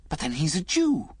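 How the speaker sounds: a touch of eager excitement; excited. A narrator's reading. Liz blurting it to Alec, mildly excited. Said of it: "she added excitedly".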